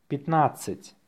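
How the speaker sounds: natural-speed dictation. Shown as a demonstration. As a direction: say it briskly, in one go.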